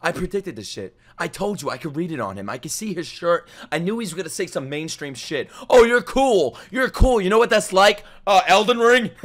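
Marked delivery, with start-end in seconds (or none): 5.7-9.1 high, loud mock admiration; sneering fake praise for a contrarian; sarcastic mockery. Streamer mocking the mid guy, loudly sarcastic.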